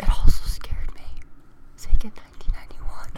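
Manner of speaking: loud, close stage whisper; mock-creepy whispered reading; ASMR whisper. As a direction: whisper the story spookily. Spooky ASMR joke reading.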